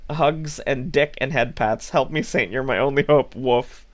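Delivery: normal talking voice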